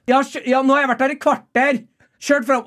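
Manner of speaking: high, indignant shout; mock outraged argument from a car window; imitated calling out. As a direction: holler it as an affronted mother. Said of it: "roper hun ut sånn".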